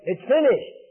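imitated cry, short of shouting; loud cry. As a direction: call it out as a triumphant declaration. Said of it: "with a loud voice".

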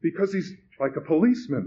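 high, anxious outburst; blurted. A narrator's reading. Frightened man explaining his fear to the host, blurted. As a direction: let it burst out nervously. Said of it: "the man blurted".

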